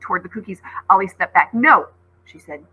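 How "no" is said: a loud sharp refusal; firm no. Acted. Girl refusing the hungry wolf, loud and firm. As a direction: slam it shut.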